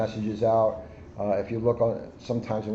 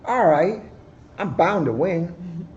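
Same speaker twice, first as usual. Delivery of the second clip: greedy boastful hare; 8 semitones higher, 5 dB louder, slower than usual. Acted. Greedy Hare accepting the race.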